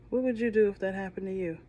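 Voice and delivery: plain reading voice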